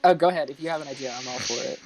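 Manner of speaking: ordinary talk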